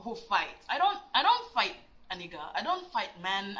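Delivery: normal talking voice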